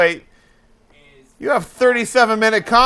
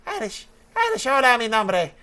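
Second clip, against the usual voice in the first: high-pitched mock Irish accent; 6 semitones higher, 7 dB louder than usual. Host trying Irish on Spanish for friends, high mock Irish accent. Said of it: "I'm trying to do the Irish".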